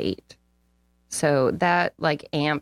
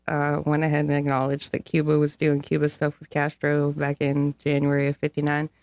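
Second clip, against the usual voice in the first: ordinary talk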